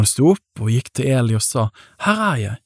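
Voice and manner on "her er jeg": higher, lightly voiced boy; Samuel. A narrator's reading. Boy comes to Eli a third time, answering higher. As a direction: pitch the reply up, then let it fall.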